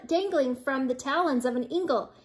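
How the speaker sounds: plain reading voice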